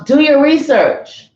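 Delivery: loud, slow all-caps yell. Retold or imitated. Mocking conspiracy posts, loud slow yell. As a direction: shout it like an all-caps post. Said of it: "they're yelling".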